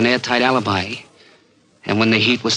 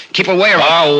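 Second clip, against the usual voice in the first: loud, cornered threat; desperate shout; 6 semitones higher, 5 dB louder than usual. Acted. Charlie warning the cop to keep away, desperate shout. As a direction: hold him back with your voice.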